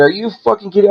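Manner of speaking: high-pitched, incredulous outburst; replayed indignation at a high pitch; retold flip-out. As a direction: act out exasperated disbelief, pitch way up. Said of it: "And I flip out".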